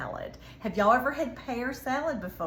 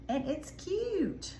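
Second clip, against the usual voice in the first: cooing it's cute; 7 semitones higher, 3 dB quieter, much slower than usual. Real, not acted. Admiring the finished plate.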